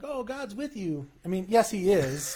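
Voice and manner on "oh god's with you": high, soft mock-pious tone; mocking cliche. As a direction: parody the empty comfort line.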